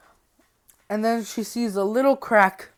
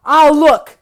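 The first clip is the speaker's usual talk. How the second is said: loud, higher, impatient cut-in; acted outburst; 7 semitones higher, 13 dB louder than usual. Freddy silencing the begging girl, loud and impatient. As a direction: cut off the pleading with a loud burst.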